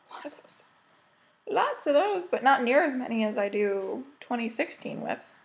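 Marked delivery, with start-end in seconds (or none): 0.1-0.6 breathy unvoiced whisper to herself; whispered aside. Real, not acted. Muttered aside, then repeated aloud. 1.5-2.3 higher, bright emphatic restatement; emphatic repeat. Real, not acted. Repeats the aside out loud.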